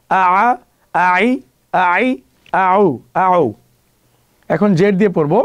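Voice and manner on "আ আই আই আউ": আ, আই, আউ drawn out very slowly with throat friction; slow drill. Shown as a demonstration. Teacher drilling slowly, drawn out and throaty. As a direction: stretch each syllable slowly for students. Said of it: "গলায় একটা ঘষা".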